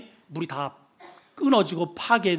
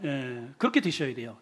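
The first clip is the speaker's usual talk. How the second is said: ordinary talk, nothing marked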